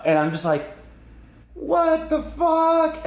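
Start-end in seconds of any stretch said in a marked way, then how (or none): 1.5-3.0 high, drawn-out bewildered wail; hammed-up bafflement; stunned disbelief. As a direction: stretch each word in mock shock. Stunned reaction in battle.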